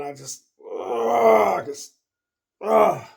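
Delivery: exasperated moan to himself; drawn-out groan. Retold or imitated. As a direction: groan it out slowly, at the end of your rope. Fed up watching the movie.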